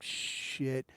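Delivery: very soft, low, drawn-out curse; hushed regret. Retold or imitated. Man hit by a moment of clarity, hushed regret. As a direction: let it leak out under your breath.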